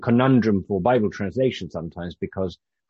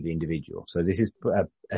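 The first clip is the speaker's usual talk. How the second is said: usual speaking voice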